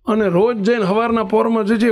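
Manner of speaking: normal talking voice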